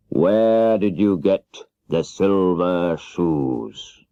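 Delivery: very slow, booming wizard question; slow, grave wizard voice; the great Oz. Acted. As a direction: draw out each word like a giant head. Oz voiced by narrator.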